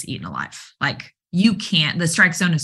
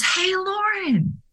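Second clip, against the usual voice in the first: high, drawn-out call of her name; mock yell across room; much slower than usual. Player calling coach across cafeteria, high drawn-out call. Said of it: "yells from the back of the line".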